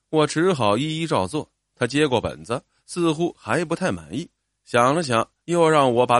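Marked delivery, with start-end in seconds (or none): none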